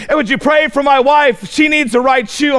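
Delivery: a teasing hint of a begging missionary; mock missionary plea. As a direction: poke fun at a visiting missionary asking for prayer. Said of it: "he would say".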